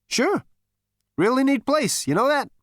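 high, loud swaggering voice; cocky Zaphod. A narrator's reading. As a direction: brag like a smug hero. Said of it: "Zaphod answers breezily".

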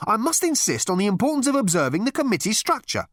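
high, officious and huffy. A narrator's reading. Management consultant lecturing Ford, huffy. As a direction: insist on procedure in a huff. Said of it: "said the man huffily".

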